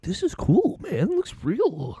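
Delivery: high, soft put-on voice; mock awed friend, drawn out at the end; imitating impressed roommates. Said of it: "and they were like".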